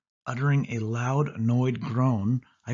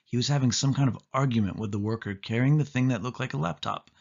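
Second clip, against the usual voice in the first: plain reading voice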